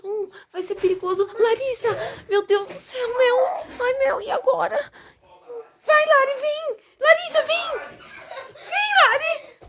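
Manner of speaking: high, loud near-sobbing panic; panicked crying. Pretend. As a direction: cry out in playful panic. Panicking as sister goes. (choro em pânico)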